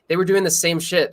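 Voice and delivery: usual speaking voice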